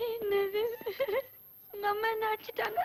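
tearful complaint; hammed-up weeping; crying. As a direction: whine it through sobs. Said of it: "என்ன செல்லம்மா அழுதுட்டு வர்ற?".

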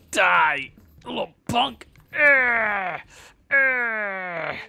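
high, loud screaming attack; villain battle yell. Pretend. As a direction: scream it at the enemy.

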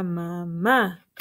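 mamá with the stress on the last syllable, ma-MÁ; stress on final syllable. Shown as a demonstration. Teacher showing children what the accent does, mamá stressed on the end. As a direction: say "mamá" slowly, landing on the final á. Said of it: "acento en la A, para que diga".

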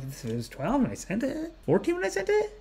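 soft, high-pitched anxious questioning; retold panicked doubt. Own panicked checking of page count.

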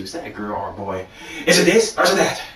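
normal talking voice

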